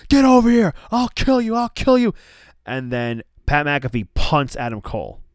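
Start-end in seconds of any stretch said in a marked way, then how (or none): 0.1-2.1 high, loud imitated screaming threat; retold furious scream. Adam Cole lunging at Pat, screaming threats. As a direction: scream it like you're held back from a brawl. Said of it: "And Adam Cole's like".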